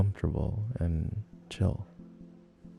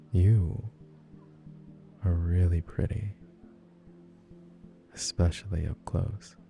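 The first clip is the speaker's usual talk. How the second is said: flirty; 3 dB quieter than usual. Acted. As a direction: say it close and intimate, teasing. Flirting compliment, soft.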